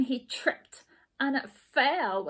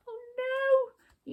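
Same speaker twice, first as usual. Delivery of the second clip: high startled gasp of dismay; shocked at the popped balloon; squeaky oh no. Acted.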